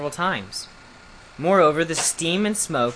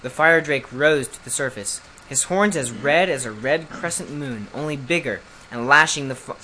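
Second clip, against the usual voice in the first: plain reading voice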